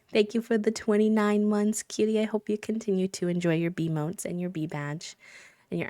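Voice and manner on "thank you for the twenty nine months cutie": usual speaking voice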